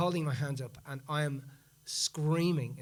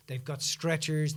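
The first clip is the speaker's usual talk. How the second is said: usual speaking voice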